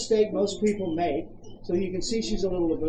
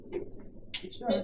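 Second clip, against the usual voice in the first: whispered stern; 2 semitones higher, 10 dB quieter than usual. Shown as a demonstration.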